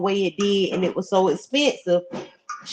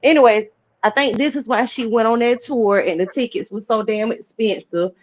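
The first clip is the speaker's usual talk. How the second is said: ordinary delivery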